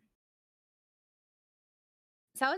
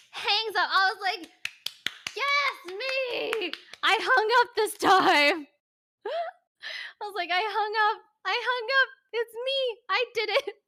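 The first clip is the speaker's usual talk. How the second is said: much higher and louder, gleeful victory shout; triumphant squeal; 12 semitones higher, 8 dB louder than usual. Real, not acted. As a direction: crow about winning, squealing with laughter. Triumphant about hanging up first.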